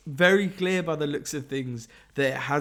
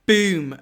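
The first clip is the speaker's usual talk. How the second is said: loud boom; 4 semitones higher, 9 dB louder than usual. Pretend. Describing an explosion in the comic.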